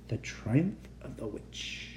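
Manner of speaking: slow, breathy eerie whisper. Acted. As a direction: whisper the title like a secret. Spooky chapter title teaser.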